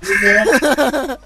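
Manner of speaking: high, loud burst of laughter; laughing. Real, not acted. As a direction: laugh out the greeting. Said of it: "Ah, putain". (éclat de rire)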